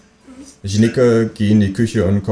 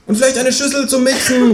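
very high kangaroo call; kangaroo calling after. Acted. Kangaroo wants a bowl too, calling after the neighbour, very high. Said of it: "ruft mir das Känguru hinterher".

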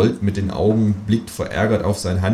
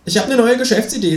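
high squeaky kangaroo voice; 3 dB louder, faster than usual. Acted. Cheeky kangaroo asking the author, high voice. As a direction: pitch it way up as the Kangaroo. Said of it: "sagt das Känguru".

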